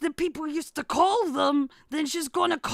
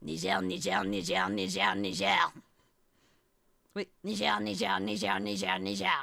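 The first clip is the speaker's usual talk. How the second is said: low, rapid-fire repetition of NIGER; five times fast; 7 semitones lower than usual. Real, not acted. Teasing after being begged not to, five times fast. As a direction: rattle off NIGER over and over in a lower voice. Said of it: "do not say that five times fast".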